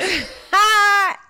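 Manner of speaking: loud squealing laugh; shrieking laugh. Real, not acted. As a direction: burst into a screaming laugh.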